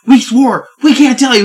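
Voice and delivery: put-on loud, high protest from threatened kids; pleading kids. Kids protesting to the threatening preacher, loud high plea.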